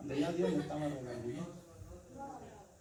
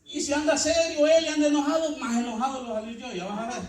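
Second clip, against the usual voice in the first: louder, higher preaching burst; full-force preaching; 9 semitones higher, 10 dB louder than usual. Acted. Preacher warning the congregation, loud and high. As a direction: hammer the point home. Fiery exhortation.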